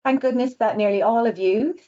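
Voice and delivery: ordinary delivery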